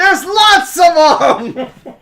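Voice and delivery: high, slow, loud shout; exuberant shouted reply. Acted. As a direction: stretch it out at full volume. Character answers how many there are.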